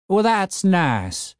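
put-on high, slow grumpy old codger; cranky old man. A narrator's reading. Old man ordering his broken zombie gardener, gruff old voice.

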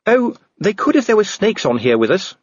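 high, bright lady; Sybil's voice. A narrator's reading. Sybil answering Vimes, high and brisk. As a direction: chirp it matter-of-fact.

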